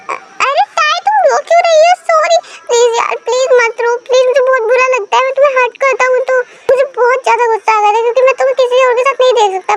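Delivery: hammed-up desperate pleading; pleading husband. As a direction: rush out apologies to stop her crying. Husband begs crying wife.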